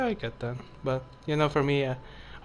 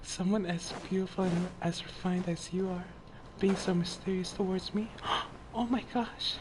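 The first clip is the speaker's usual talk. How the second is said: put-on girl voice; 5 semitones higher, 3 dB quieter than usual. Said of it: "I'm starting to do my girl voice".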